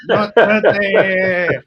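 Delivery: the last word on it, stretched; even stronger not today. Real, not acted. Fan doubling down on the derby, drawn-out loud refusal. Repeated, even more emphatic.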